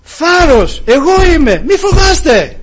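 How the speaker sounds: high, loud reassuring call. Retold or imitated. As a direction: shout across the waves to calm them. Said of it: "ο Ιησούς φώναξε και τους λέει". (δυνατή καθησυχαστική φωνή)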